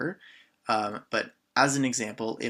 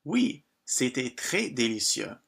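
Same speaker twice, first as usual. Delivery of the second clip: slow, careful French answer; drawn-out teaching read; slow model French. Shown as a demonstration. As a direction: say it slowly so learners can copy. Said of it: "teacher models the French answer".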